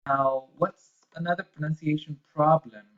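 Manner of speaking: ordinary talk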